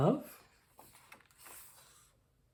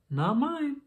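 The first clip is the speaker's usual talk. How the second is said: put-on high child's voice, higher; 10 semitones higher than usual. A narrator's reading. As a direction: another small child answering the teacher. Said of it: "said another".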